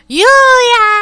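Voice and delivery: loud high-pitched cry of a name; sudden high shout. Acted. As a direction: yell it out in surprise. Voicing game character shout.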